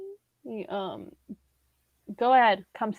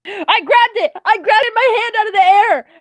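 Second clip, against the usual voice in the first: real high, loud burst of triumph; excited shout; 11 semitones higher, 7 dB louder than usual. Real, not acted. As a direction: crow loudly over the catch. Caught a mosquito, exclaims.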